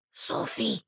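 hissed whisper of a name. A narrator's reading. Auntie secretly whispers to Sophie. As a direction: whisper to get her attention.

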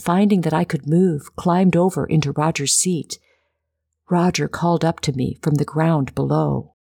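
usual speaking voice

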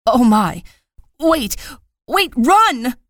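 high, loud panicked screaming; teacher screaming in terror as rabbits attack; frantic scream. A narrator's reading. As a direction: shriek at them to flee. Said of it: "frantic words tore through the trees".

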